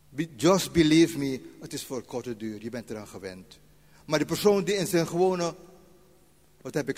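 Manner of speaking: ordinary talk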